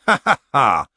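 loud, deliberate solemn "ha, ha, ha"; solemn mock laugh. A narrator's reading. Gamma answering whether anyone tried a cake, solemn laugh. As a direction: laugh in separate solemn words, no mirth. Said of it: "said Gamma solemnly".

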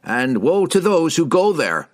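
high, rising warning cry; ominous warning. A narrator's reading. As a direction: warn them off with mounting force. Villager warning the strangers.

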